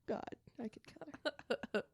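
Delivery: joking murderous whisper; hushed mock menace. Real, not acted. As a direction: breathe it out like a secret threat. Joking about killing coworker.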